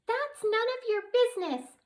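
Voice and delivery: very high tiny voice, sobbing; tiny fairy sobbing at the twins; tearful fairy. Acted. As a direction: snap through tears in a tiny voice. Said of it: "and sobbed some more".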